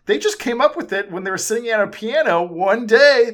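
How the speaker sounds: mocking, high and loud; sarcastic rant. Real, not acted. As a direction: scoff at the cliché. Sarcastic rant about biopics.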